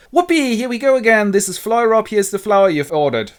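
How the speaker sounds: high, loud sprite voice; gleeful Puck. Acted. Puck delivering the flower to Oberon, gleeful. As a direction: whoop and announce it at high pitch.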